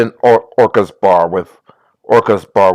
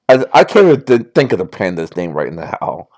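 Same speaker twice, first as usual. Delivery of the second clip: ordinary talk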